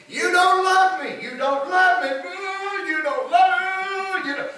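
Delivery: high, sing-song whine of "you don't love me"; hammed-up pleading wife, half sung; mock whining wife. Wife nagging Samson, crying.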